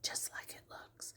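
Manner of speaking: unvoiced whisper under the breath; whispered aside. Real, not acted. Nurse whispers an aside to viewers. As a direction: whisper it as a little secret. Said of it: "aside whisper".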